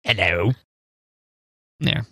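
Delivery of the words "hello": low ghoulish hello; a creepy Palpatine-style greeting; horror host. Pretend. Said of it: "can you do that voice".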